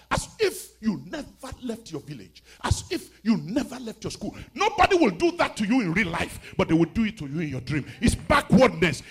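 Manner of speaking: loud, hammering preacher; full-force preaching. Real, not acted. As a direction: drive each phrase home at full volume.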